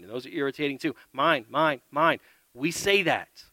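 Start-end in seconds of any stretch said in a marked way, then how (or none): none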